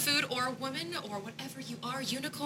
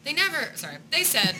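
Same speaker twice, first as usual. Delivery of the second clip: ordinary talk, nothing marked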